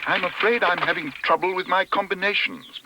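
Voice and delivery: high-pitched worried cry. A narrator's reading. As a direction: call out the trouble high and apologetic. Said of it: "cried Paddington".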